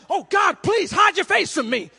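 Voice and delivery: high, loud begging; loud pleading. Retold or imitated. Preacher voicing a sinner pleading with God, loud. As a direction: beg God loudly to look away.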